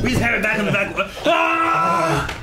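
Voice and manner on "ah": loud, high mock battle cry; hammed-up scream; high shouted yell. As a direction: let out a big high-pitched yell. Imitating background yells.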